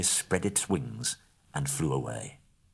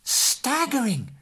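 much higher and louder, gushing praise; exclaiming in awe; 5 dB louder, slower than usual. A narrator's reading. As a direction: overdo the amazement to flatter. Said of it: "exclaimed Bilbo aloud".

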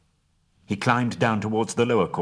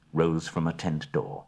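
ordinary delivery, nothing marked

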